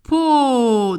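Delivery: PO stretched long and loud; drawn-out syllable. Shown as a demonstration. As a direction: hold "Po" on a long, loud vowel. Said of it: "je découpe toujours mon mot en son".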